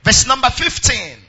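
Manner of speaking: reading the verses out loud in an unhurried preaching cadence; proclaiming scripture over the music. Acted. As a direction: lead the church through the verses, full voice.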